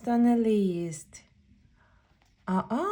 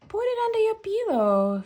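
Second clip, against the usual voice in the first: high, quick mom voice; bright high mother; mom's voice; 11 semitones higher, 3 dB louder, much faster than usual. Acted. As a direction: chirp it like a busy mom.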